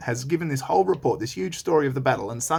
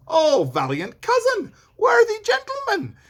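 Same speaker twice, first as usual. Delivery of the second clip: high, loud, grand royal praise; King Duncan; 6 dB louder than usual. Acted. Teacher voicing King Duncan praising Macbeth, high loud delight. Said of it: "Duncan replies".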